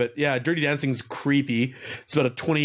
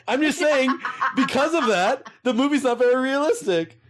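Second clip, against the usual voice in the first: laughing; 10 semitones higher than usual. Real, not acted. Laughing after a joke.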